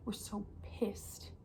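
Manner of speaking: slow, soft, tight whisper of rage; seething whisper. Real, not acted. Telling viewers how angry we are, seething whisper. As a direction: hold the anger down, barely voiced.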